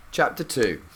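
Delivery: usual speaking voice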